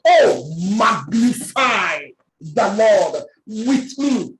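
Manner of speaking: slow, drawn-out invitation; slow preached verse. Acted. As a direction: call them slowly to join in. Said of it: "slow reading of verse".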